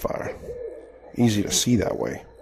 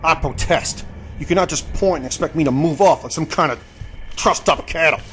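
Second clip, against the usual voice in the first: higher, offended gentleman's protest; indignant protest; 6 semitones higher than usual. Acted. Captive protesting to his captor, indignant and higher. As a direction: bristle at being herded like cattle.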